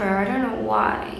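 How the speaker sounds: normal talking voice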